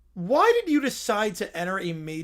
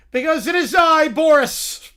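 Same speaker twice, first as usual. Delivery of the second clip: proud, louder declaration of self; grand announcement; 6 semitones higher, 8 dB louder than usual. Acted. Boris proclaiming himself to the couple, louder and grand. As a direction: boom it out like a pompous ruler.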